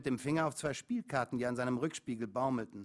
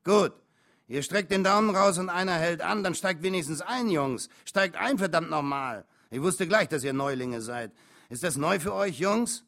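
loud, high trucker rant; exasperated, forceful dressing-down; angry scolding; 4 semitones higher, 8 dB louder than usual. A narrator's reading. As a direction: lecture the greenhorns at full voice.

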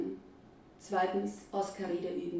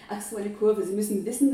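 louder, nervously chatty; a flustered first-time flyer, louder; anxious loud; 7 dB louder than usual. Acted. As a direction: talk fast to cover the fear. Anxious flyer reassuring herself.

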